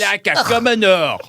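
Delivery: put-on French accent. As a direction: sneer it like a snooty Frenchman. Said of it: "Why do we keep going French?".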